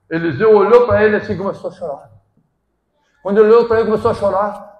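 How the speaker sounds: fervent sermon voice; forceful preaching. Acted. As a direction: proclaim it to the congregation.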